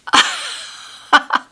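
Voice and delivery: squeaky high laugh on the word; can't stop laughing. Real, not acted. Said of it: "don't know why I can't stop laughing".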